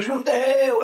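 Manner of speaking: high, drawn-out mock yell; imitated heckling shout. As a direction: call it out high and stretched. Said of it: "Eu gritei assim".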